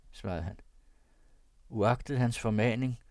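ordinary delivery

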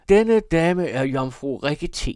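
loud, high-pitched tipsy announcement; drunken proclamation. A narrator's reading. Drunk brother presenting his company, loud and high. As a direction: proclaim it grandly, far above narration level.

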